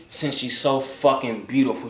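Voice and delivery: ordinary delivery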